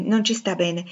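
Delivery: ordinary delivery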